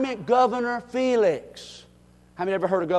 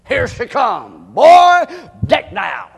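admiring exclaim; 3 semitones higher, 12 dB louder, slower than usual. Real, not acted. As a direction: marvel at her entrance.

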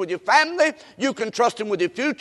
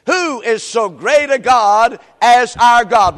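loud, very slow pulpit cry; scripture proclamation; 7 dB louder, slower than usual. Real, not acted. Preacher proclaims God's greatness to the congregation, loud and slow. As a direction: draw out each word at full force. Leads congregation in repeating verse.

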